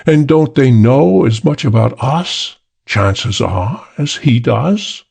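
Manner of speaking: condescending English gentleman; British voice. A narrator's reading. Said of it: "The British voice".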